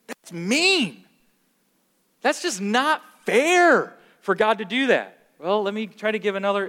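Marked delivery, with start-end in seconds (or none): 0.1-5.2 high, loud, drawn-out protest; complaining people. Acted. People objecting to talk of hell, whining protest. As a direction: whine it like an indignant objector. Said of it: "people say".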